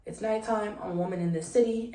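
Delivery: normal talking voice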